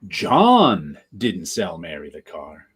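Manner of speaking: "John" stretched and punched, the rest falls low; long, heavy "John" as the key word; stress on JOHN. Shown as a demonstration. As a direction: hit "John" hard, then drop away. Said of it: "you put the emphasis on each".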